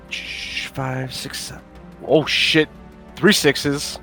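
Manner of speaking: normal talking voice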